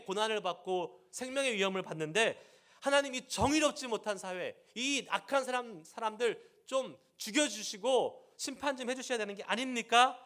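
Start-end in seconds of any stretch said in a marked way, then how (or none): 2.8-10.2 raised, louder pleading cry to God; pleading Jeremiah. Retold or imitated. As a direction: beg God for justice, voice lifted high. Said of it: "라고 이렇게 질문을 하는데".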